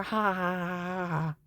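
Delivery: hollow mock ha-ha; dry sarcastic ha ha ha; sarcastic fake laugh. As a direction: laugh it off bitterly, flat. Bitter laugh after giving in.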